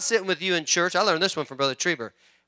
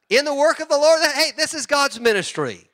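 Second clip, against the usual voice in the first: raised, emphatic preaching; 9 semitones higher than usual. Real, not acted. Pastor to his church, preaching with force. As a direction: proclaim it to the congregation.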